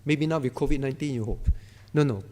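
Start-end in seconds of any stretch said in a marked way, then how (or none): none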